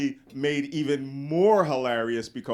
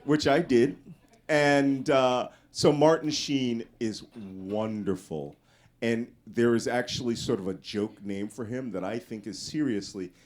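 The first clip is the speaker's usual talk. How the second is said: ordinary talk, nothing marked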